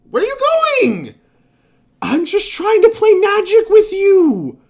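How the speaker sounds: high, slow, wailing plea; mock whiny plea. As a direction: whine it to the card, drawn out. Mock plea to fleeing Garrison.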